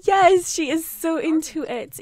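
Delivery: loud, high, drawn-out delight; thrilled, stretched-out gushing; excited cheering. Real, not acted. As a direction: cheer on the crush, slowly and loudly. Cheering on the game romance.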